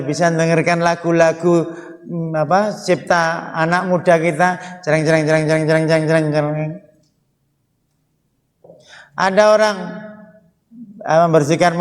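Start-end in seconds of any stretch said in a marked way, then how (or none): 4.8-6.7 imitated guitar strum. Imitating music on the radio.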